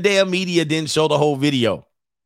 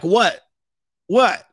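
higher-pitched, short defensive question; mock clueless indignation; 5 semitones higher, 3 dB louder than usual. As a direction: imitate her clueless comeback. Acts out woman being yelled at.

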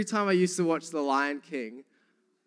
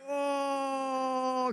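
long, high, held "oh"; re-enacted relief moan; 9 semitones higher, 4 dB quieter than usual. Re-enacts peeing into pillow.

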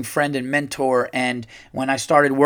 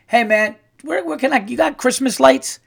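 asking a worker; 8 semitones higher than usual. Retold or imitated. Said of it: "I say to this guy".

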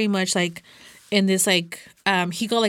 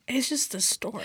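breathy, whispered brush-off; a whispered it's just the store; whispered shrug; 4 semitones higher, 7 dB quieter than usual. Retold or imitated. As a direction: mutter it under your breath, unbothered. Brushing off a friend's advice.

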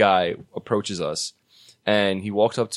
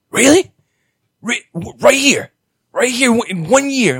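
much higher and louder, eager disbelief; excited drunk fan; 13 semitones higher, 7 dB louder than usual. Retold or imitated. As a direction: squeak with amazed hope. Drunk fan amazed by Terminator.

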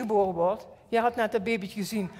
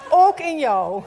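laughing; 5 semitones higher, 10 dB louder, slower than usual. Real, not acted. Laughing through sermon.

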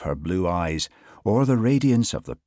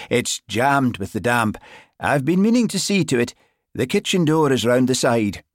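loud, higher Highland excuse; flustered Highland accent; 6 semitones higher, 5 dB louder than usual. A narrator's reading. Sergeant explaining the jammed door, flustered Highland accent. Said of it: "His Highland accent always grew more pronounced when he was flustered".

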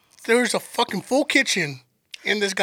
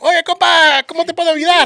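higher, louder cheerful clerk in Spanish; Mexican clerk voice; 8 semitones higher, 6 dB louder than usual. Retold or imitated. Tiny clerk greeting customer from behind, higher cheerful Spanish. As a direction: greet him brightly like the clerk.

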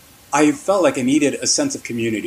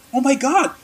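much higher, excited surprised squeal; surprised exclamation; 12 semitones higher than usual. Retold or imitated. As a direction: voice the pastor's delighted shock at seeing him. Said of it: "she was really surprised to see me".

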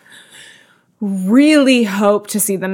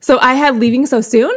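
ordinary talk, nothing marked

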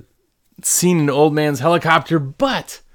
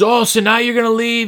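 mock yell; 7 semitones higher than usual. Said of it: "He's yelling at him".

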